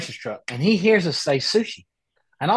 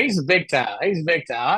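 normal talking voice

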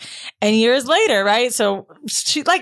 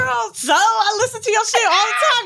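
high-pitched, gushing mock shout; imitated eager fan; 8 semitones higher than usual. Retells fan's eager drug offer.

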